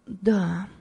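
understated fearful reply; trembling voice. A narrator's reading. Frightened guest answers about her surname, voice trembling. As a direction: answer timidly, with a touch of a tremble. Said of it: "дрожащим голосом ответила".

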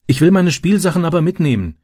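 put-on high-pitched boy's protest; child insisting on his toys. A narrator's reading. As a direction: object insistently, pitched well up. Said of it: "protestierte Francis".

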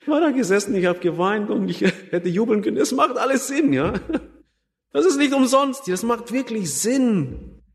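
high, laughing testimony of relief; jubilant, breaking into laughter; overjoyed, laughing. Real, not acted. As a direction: laugh through the words, pitched well up. Joyful retelling of his conversion.

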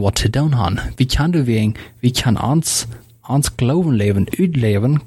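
ordinary talk

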